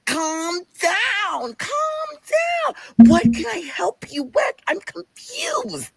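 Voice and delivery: very high, slow put-on wail; screechy, stretched-out mock soothing; mock coworker voice. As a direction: imitate a flustered coworker, dragging each word. Bernadette's put-on voice again.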